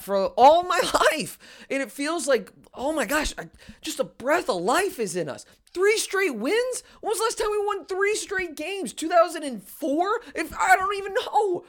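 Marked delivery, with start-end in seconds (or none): 5.7-11.6 high, excited questions about the streak; can't believe the streak, giddy; thrilled disbelief. Real, not acted. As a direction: fan overjoyed at three straight wins, excited. Fan thrilled by winning streak.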